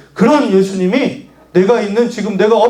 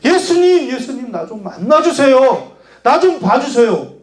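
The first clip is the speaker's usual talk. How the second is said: high, pleading calls for attention; persistent calling; 6 semitones higher than usual. Acted. Blind man keeps calling Jesus, high pleading cry. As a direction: call the name high, then beg to be noticed. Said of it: "계속해서 예수님의 이름을 불렀어요".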